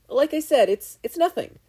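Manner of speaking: very high, loud flustered stammer; flustered modesty. A narrator's reading. Boy brushing off her thanks, flustered. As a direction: brush it off in a panic. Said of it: "needed to learn how to take a dang compliment".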